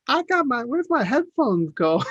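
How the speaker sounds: put-on high-pitched toddler voice, words drawn out; whiny toddler. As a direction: play a little kid fussing over lost headphones. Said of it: "like, a toddler".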